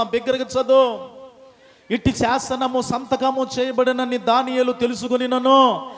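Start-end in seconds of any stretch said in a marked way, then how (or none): 1.9-5.9 loud, slowing solemn reading; stately Bible reading; scripture reading. Acted. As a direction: read the verse out to the church. Scripture read aloud.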